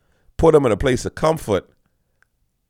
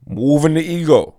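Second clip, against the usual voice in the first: ordinary talk, nothing marked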